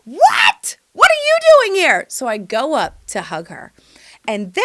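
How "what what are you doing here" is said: very high, loud squeal of surprise; excited shriek. Retold or imitated. Greeting a friend at the park, surprised squeal. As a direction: shriek in mock astonishment.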